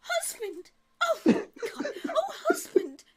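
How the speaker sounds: very high, melodramatic wail; flustered wife. Acted. Wife reproaching her husband, flustered high wail. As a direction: lament in a hammed-up high lady's voice. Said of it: "she's beginning to get flustered".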